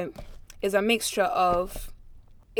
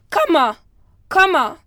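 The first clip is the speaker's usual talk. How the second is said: called out higher and louder, falling on each; calling out; 8 semitones higher, 8 dB louder than usual. A narrator's reading. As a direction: call the goose by name across the room. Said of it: "kept calling".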